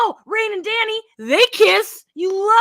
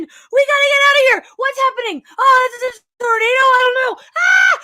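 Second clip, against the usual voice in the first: frantic yelling dad, loud and high; panicked shouting; 5 semitones higher, 5 dB louder than usual. Retold or imitated. Dad yelling at his family to flee, frantic shout. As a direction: yell like the storm just hit. Dad voiced in tornado recap.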